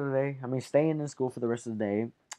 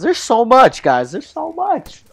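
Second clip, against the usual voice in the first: excited; 11 semitones higher, 11 dB louder than usual. Real, not acted. Excited promise to viewers.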